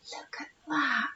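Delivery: hushed whisper; breathless whispered look. Acted. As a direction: whisper it like a secret. Hushed aside in underwater story.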